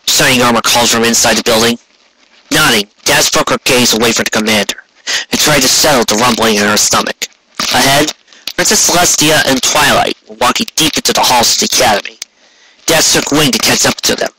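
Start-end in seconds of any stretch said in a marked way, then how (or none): none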